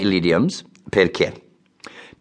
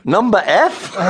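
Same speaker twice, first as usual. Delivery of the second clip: laughing; 13 semitones higher, 4 dB louder than usual. Real, not acted. Joking about early morning.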